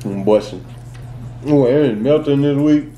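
chewing while talking; talking between bites; mouth full of cookie. Real, not acted. Seen 0: Bites cookie at 0.9, chews with closed lips, then talks.

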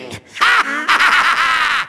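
long rattling villain laugh; theatrical rapid-fire evil laughter; evil cackle. Acted. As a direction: cackle in gloating triumph. Villain gloats.

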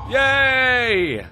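high, loud, drawn-out cheer; gleeful cheer. Real, not acted. Player celebrating a success in the game, gleeful cheer.

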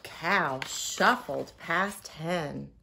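ordinary delivery, nothing marked

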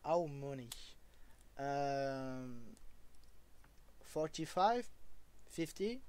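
English spoken with his own French accent; French-accented English numbers. Real, not acted. Streamer answering a viewer in French-accented English. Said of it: "Désolé si mon accent vous choque".

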